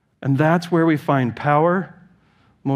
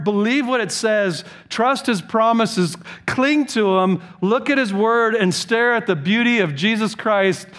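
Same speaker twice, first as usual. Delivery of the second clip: raised, pleading preacher's exhortation; impassioned preaching; 7 semitones higher than usual. Acted. Pastor urging his congregation, impassioned preaching. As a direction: stir the flock to put on Christ.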